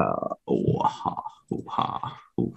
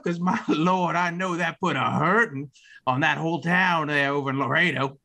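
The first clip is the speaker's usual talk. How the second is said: much higher, hammed-up Louisiana drawl; 9 semitones higher than usual. Bella mistakes Stan for Sam in Louisiana drawl. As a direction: gush like a Southern belle spotting an old friend. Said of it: "my Louisiana draw".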